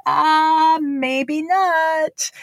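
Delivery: high, knowing maybe not; high sing-song tease; teasing warning. Retold or imitated. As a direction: warn him with a wry grin. Warning friend about script.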